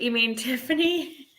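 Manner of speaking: "you mean Tiffany?" said through a laugh. Real, not acted. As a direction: giggle through the question. Laughing at a chat message.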